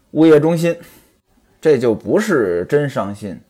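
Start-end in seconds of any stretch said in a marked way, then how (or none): none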